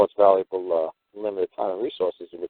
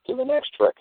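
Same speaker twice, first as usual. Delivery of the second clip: exasperated squeal. Retold or imitated. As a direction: Do the next trick in a sudden high mock squeal.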